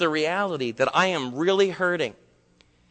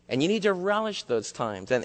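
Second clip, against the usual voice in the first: usual speaking voice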